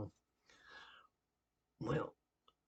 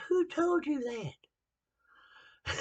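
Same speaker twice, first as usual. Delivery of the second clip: drawn-out retort; 13 semitones higher, slower than usual. Retold or imitated. Preacher answering his critics, slow and higher. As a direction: stretch out every word of the comeback.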